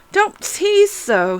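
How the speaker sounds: put-on a child's high, drawn-out complaint; impatient protest. A narrator's reading. Alice scolds the little voice.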